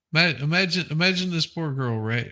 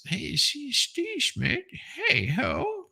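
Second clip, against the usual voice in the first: mock grandpa; 10 semitones higher, 5 dB quieter, much slower than usual.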